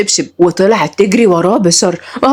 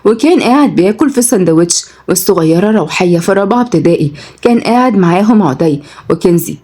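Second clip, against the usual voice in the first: ordinary delivery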